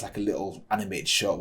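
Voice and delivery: ordinary delivery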